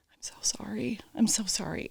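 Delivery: breathless whisper, over and over; whispered apology. Retold or imitated. Husband apologizing to his wife, whispered and contrite. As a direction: whisper it, ashamed and sorry.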